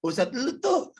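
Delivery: high teasing jeer; mocking gossip. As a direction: tease like a nosy relative. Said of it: "suka pada ngomong gitu".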